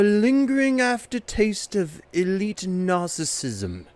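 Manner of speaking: a smug English gentleman savouring wine; Queen's English. Pretend. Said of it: "an impeccable English accent, just the Queen's English".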